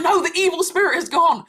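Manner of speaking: high-pitched, emphatic retold correction; excited insistence. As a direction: imitate someone thrilled and insisting. Client insists on her big news.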